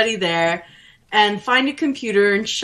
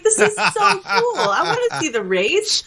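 excited; 11 semitones higher than usual. Retold or imitated. Excited self retold.

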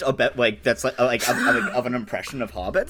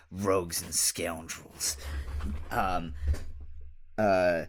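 much softer, low, confidential; hushed confiding; 5 semitones lower, 8 dB quieter than usual. Acted. Halfling confiding to the creature, hushed. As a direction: lean in and confide to win him over.

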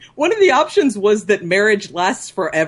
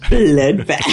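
a lower, louder Bloodbath; imitating Rage; 5 semitones lower, 5 dB louder than usual. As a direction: copy Rage's deep Bloodbath. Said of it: "I like the way Rage says bloodbath".

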